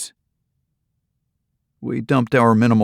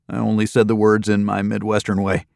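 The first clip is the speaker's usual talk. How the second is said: ordinary delivery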